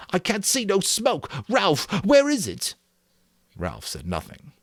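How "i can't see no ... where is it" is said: put-on high, anxious disbelieving boy; incredulous asking. A narrator's reading. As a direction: demand an answer. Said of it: "Piggy incredulously".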